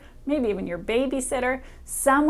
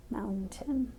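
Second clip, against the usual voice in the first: soft listening word; 9 dB quieter than usual. Shown as a demonstration. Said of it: "Is there a k sound".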